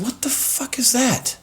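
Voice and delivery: high, breathless whispered alarm; startled whisper. Retold or imitated. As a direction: hiss it out in sudden fear. Startled by buzzing during treatment.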